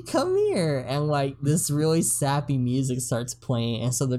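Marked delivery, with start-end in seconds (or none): 0.0-0.8 much higher sweet coaxing call. Retold or imitated. Ernest calling the troll over, high and coaxing. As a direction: coax the troll in gently. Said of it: "he's like".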